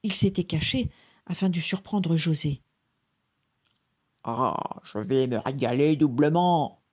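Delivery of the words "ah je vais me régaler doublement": gloating forest ogre voice; greedy genie relishing his catch; exclaiming genie. A narrator's reading. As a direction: exclaim it in a big put-on monster voice. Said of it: "s'exclama le génie".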